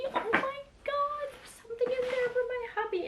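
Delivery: high, tearful squeal; about to cry. Real, not acted. Vlogger finding a gift for hubby, tearfully overwhelmed. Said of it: "I'm gonna cry".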